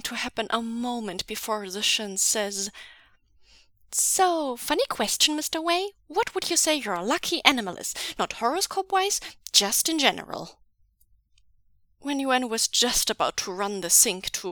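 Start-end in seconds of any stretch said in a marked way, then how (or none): 3.9-10.6 high, quick, probing voice; sly question. Acted. Zishan testing Mister Wei with a sly quick question. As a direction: ask it casually but hurry.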